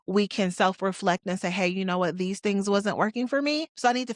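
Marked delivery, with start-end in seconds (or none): none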